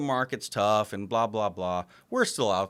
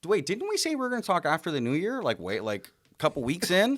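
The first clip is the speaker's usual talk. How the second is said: incredulous; 7 semitones higher than usual. Retold or imitated. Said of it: "And I'm like".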